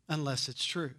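soft emphasis. Shown as a demonstration.

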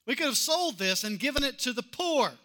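mock scolding critic; critic's scolding. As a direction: wag a finger at her. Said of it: "they scolded her".

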